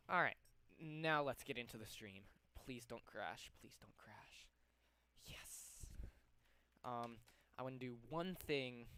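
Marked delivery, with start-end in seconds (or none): 3.6-4.5 barely audible, voiceless whisper; whispered plea. Real, not acted. Streamer begging the software not to crash, whispered. Repeats the plea under breath. 5.3-5.9 tiny voiceless whisper of victory; whispered relief. Real, not acted. Streamer seeing the stream start without crashing, whispered.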